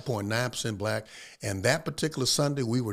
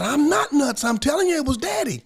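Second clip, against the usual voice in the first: much higher, louder desperate insisting; insistent shout; 13 semitones higher, 5 dB louder than usual. Retold or imitated. Wife insists to her brother she saw her dead father, shouting. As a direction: shout it, she won't be doubted.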